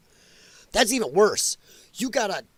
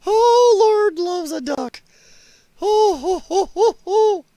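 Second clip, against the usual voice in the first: very high, loud, slow exclamation; appalled by a picture, hooting; shocked exclamation; 13 semitones higher, 6 dB louder, slower than usual. Real, not acted. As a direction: squeal it out in horrified glee.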